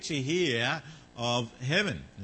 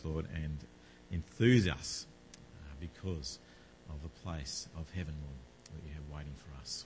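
prayer; 6 semitones lower than usual. Acted. Pastor praying before the Bible reading, reverent prayer. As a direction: pray aloud to God on the congregation's behalf.

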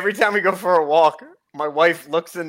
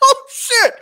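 much higher, laughing cry; laughing shock. Real, not acted. Reacting to his friend's joke, laughing shocked cry.

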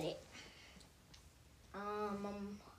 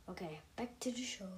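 whisper; 9 dB quieter, faster than usual. Acted. Whispered show return.

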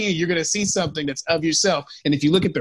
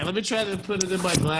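distant from the microphone; mic hanging off glasses; far from mic; 6 dB quieter than usual. Real, not acted. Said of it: "you sound like far away".

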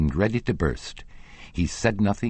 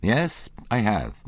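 slow, raised, emphatic declaration; declaring; 7 semitones higher, slower than usual. A narrator's reading. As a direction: announce it with slow conviction. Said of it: "Monsieur Lachenel declared".

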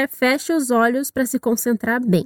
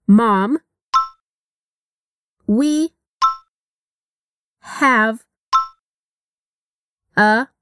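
each word slowly, with long pauses; 3 semitones lower, much slower than usual. Shown as a demonstration. Teacher splitting the phrase into single words. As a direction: break it apart word by word. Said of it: "O som do have se junta".

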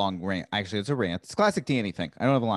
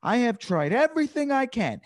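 higher, slow exasperated scolding; mad at a heckler; 8 semitones higher than usual. Shown as a demonstration. Comedian finally loses patience with the heckler, slow and exasperated. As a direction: spell out every word, fed up. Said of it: "I, like, get so mad".

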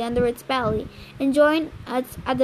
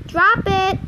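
higher-pitched command to a dog; firm, raised-pitch command; ordering; 6 semitones higher, 3 dB louder than usual. A narrator's reading. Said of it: "Annabeth ordered".

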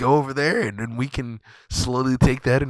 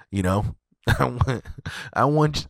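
ordinary talk, nothing marked

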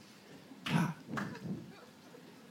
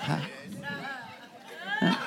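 ordinary delivery

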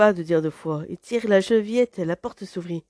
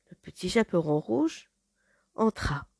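ordinary delivery

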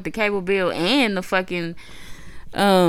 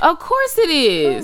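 sarcastic; 9 semitones higher, 5 dB louder, slower than usual. Retold or imitated. Sarcastic retort.

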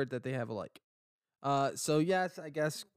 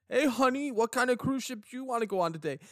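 cheery husband; 10 semitones higher, 5 dB louder, faster than usual. Acted. Husband voiced in a cruise skit.

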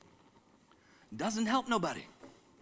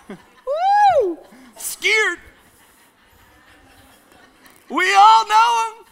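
playful woo; 13 dB louder, much slower than usual. Real, not acted. Playful mock fright at congregation.

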